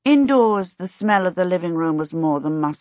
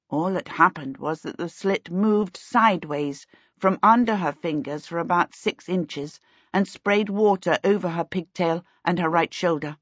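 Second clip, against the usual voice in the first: ordinary delivery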